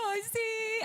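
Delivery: high-pitched imitation of tearful agreement; mock crying. Preacher retelling how she cried with the guard, mock crying. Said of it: "me solté más llorando y yo".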